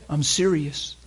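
ordinary talk

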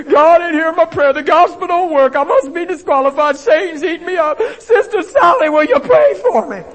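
high, loud hammed-up wailing; mock despair. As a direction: beg for help in exaggerated panic. Acts out believer despairing, begging prayer.

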